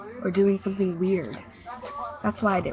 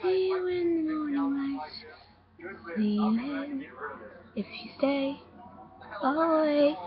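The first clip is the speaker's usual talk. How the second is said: put-on little girl's voice; 8 semitones higher, 3 dB quieter than usual. Ghostly girl sending the narrator to sleep, eerie high child voice. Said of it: "I heard a little girl's voice".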